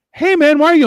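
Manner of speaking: high-pitched mock complaint; mildly whiny imitation of an onlooker; protesting bystander. Onlookers' acted protest at his threat.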